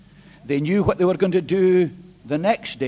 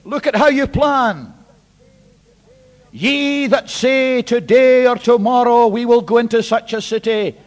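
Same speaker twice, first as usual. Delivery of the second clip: loud, higher, driving sermon voice; full-force preaching; 4 semitones higher, 8 dB louder than usual. Real, not acted. Preacher confronting the congregation with verse thirteen, loud. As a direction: hammer the scripture home.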